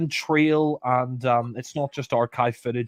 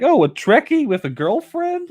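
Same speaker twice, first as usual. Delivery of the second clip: high-pitched, incredulous mimicry; scornful quote, pitched way up; mocking film quote; 11 semitones higher, 4 dB louder than usual. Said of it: "what was with that Star Trek reference".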